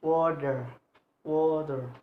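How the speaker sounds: "water" stretched into WA-ter, falling; careful, lengthened "water"; two syllables shown. Shown as a demonstration. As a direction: draw out both syllables of "water". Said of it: "This word have two syllable".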